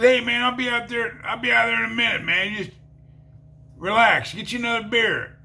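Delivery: high, fast call from another room; calling back. Retold or imitated. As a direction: shout back, brushing him off. Dealer shouting back from room.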